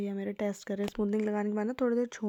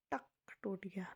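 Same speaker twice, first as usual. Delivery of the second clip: whisper; 8 dB quieter than usual. Real, not acted. A hair snaps during the test.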